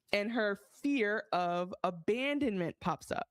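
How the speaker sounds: ordinary delivery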